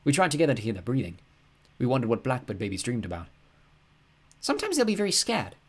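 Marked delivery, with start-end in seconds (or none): 4.4-5.6 lightly voiced young girl, higher; a girl's earnest voice, pitched up; Mina's voice. A narrator's reading. Girl telling her friend about the birds, higher. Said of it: "said Mina".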